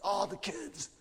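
high, hushed hyper gushing; super hyper. Retold or imitated. Youth pastor announcing foot washing to the retreat, hyper. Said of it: "he was super hyper".

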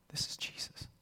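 hushed, breathy whisper; a reverent whisper. Real, not acted. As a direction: breathe it in awe. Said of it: "whispered repeat".

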